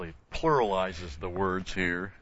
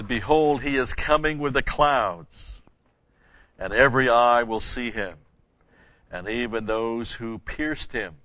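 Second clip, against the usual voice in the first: louder, higher, slow solemn reading; scripture reading; 3 semitones higher, 6 dB louder, slower than usual. Acted. Preacher reading Revelation aloud to the congregation, solemn. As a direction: proclaim it from the pulpit.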